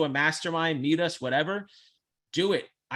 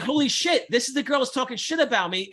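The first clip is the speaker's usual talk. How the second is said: high, loud stunned realisation; gasping it out as it dawns on him; retold shocked exclamation; 8 semitones higher, 4 dB louder than usual. Retelling to his cohost the moment he recognised her, high and stunned.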